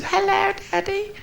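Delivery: put-on high, slow child's voice; little girl. Little girl answering her father's phone call, high and sweet. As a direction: chirp it like a small daughter. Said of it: "the little voice said".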